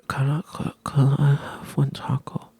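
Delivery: put-on high, slow whisper of a small kid asking; childlike plea. Kid asking the cook for one taco, whispered high.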